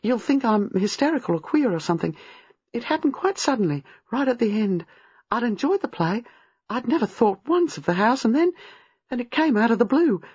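put-on high, breathless-to-explain young woman; hurried, agitated. A narrator's reading. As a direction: tumble through the events anxiously. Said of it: "speaking rather fast".

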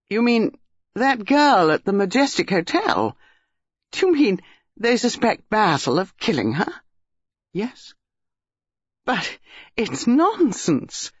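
high, incredulous questioning; shocked disbelief. A narrator's reading. Dinah hears Basil is suspected of killing, shocked disbelief. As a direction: protest in rising disbelief.

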